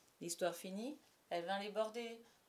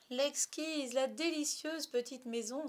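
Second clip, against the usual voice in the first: high-pitched, lingering delight; drawn-out admiring exclamation; exclaiming; 6 semitones higher, slower than usual. Acted. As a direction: exclaim with delight, stretching the words. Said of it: "s'exclama Wendy".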